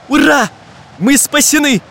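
high-pitched hurrah; joyful cheering. A narrator's reading. Travellers receive parachutes as they fall, cheering joyfully. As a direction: cheer with relief.

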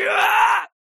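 sudden high scream of pain; cry of pain. Acted. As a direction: break off into a scream. Limb pierced, cut-off cry. (внезапный крик боли)

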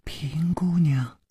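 hushed, drawn-out whispered call; soft call. Acted. Someone calling Miss Ping from outside the door, soft call. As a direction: call out gently, almost all breath. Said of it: "门外传来一个人轻轻的呼唤".

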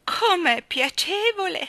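high, drawn-out, loud cry of delight; joyous cry. A narrator's reading. Miss Tartina whirling upside down, joyous cry. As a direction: cry it out gleefully, high and slow. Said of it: "gridò giocondamente la signorina Tartina".

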